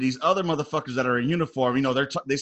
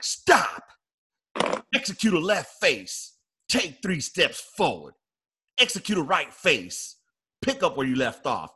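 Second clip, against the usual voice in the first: drill commands; 3 semitones higher than usual. Retold or imitated. First sergeant halting a soldier at the board, commanding. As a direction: issue slow facing commands, raised in pitch.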